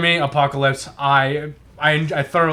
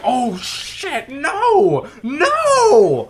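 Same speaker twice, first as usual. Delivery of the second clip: rising to a high, loud laughing shriek; mock panic; 12 semitones higher, 5 dB louder, much slower than usual. Viewer panicking at the overpowered villain, rising mock shriek. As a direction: panic like a viewer watching a hero lose. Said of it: "So you're like".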